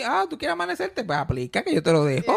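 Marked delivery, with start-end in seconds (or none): 0.0-1.0 very high, sarcastic challenge; mock sarcastic reply. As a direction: needle the customer with it. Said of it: "quoted reply".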